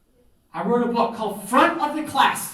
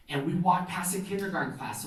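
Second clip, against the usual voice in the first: usual speaking voice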